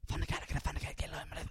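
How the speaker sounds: hushed street-hustler whisper; voiceless sneaky whisper; suspicious whisper. Acted. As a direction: whisper it like a sly street call. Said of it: "a suspicious whisper whistle".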